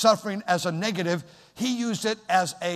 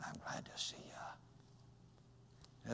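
imitating a man with no voice box; breathy, slow, toneless whisper; voiceless whisper; 7 semitones lower, 25 dB quieter, slower than usual. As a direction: rasp it out with no voice at all. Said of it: "His voice box has been removed".

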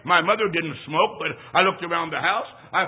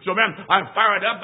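ordinary delivery

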